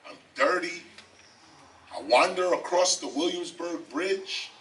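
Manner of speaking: normal talking voice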